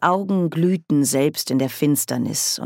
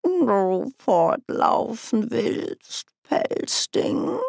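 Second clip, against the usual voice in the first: slow, high, half-whispered taunt; menacing cat-man; 6 semitones higher, much slower than usual. A narrator's reading. Grebo taunts the captured mouse, slow and teasing. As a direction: drag it out like a cat toying with prey. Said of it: "fragte Grebo".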